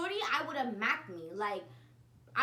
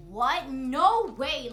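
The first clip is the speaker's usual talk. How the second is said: shocked disbelief; 3 semitones higher, 11 dB louder, much slower than usual. Retold or imitated. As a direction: react like you can't believe the text. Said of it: "I'm just there like".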